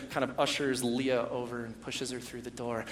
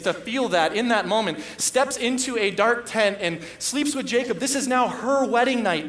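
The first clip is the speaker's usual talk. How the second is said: higher and louder, pleading sermon; impassioned preaching; 8 semitones higher, 8 dB louder than usual. Acted. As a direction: preach it, pressing hard on each phrase. Preaches Leah's longing.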